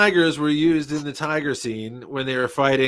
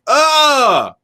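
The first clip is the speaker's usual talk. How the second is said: shocked cry; 10 semitones higher, 6 dB louder than usual. Retold or imitated. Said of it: "And they're like this".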